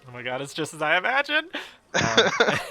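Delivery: high, loud swooning gush; gushing lady. Acted. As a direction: swoon like a dream came true.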